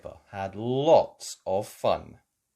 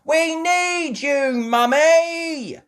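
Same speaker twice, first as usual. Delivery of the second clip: high, slow, loud calling; 10 dB louder, much slower than usual. A narrator's reading. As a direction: call desperately for help. Peppa calling mum.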